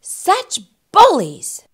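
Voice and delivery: high, loud, slow exclamation; huffy, drawn-out complaint; indignant. Acted. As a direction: stretch it out in wounded outrage. Bully indignant at being laughed at.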